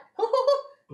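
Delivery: shrill delighted creature giggle; high creature giggle. Acted. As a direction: cackle with glee at them. Creature laughing at party.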